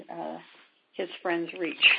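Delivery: usual speaking voice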